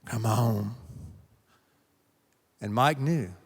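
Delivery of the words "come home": put-on low smoker father calling his boy home; deep, rough fatherly summons; deep, raspy dad voice. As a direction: rasp it out like a chain-smoking dad. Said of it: "in a very deep, raspy voice".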